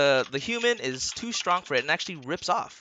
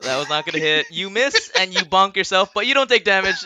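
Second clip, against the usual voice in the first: ordinary delivery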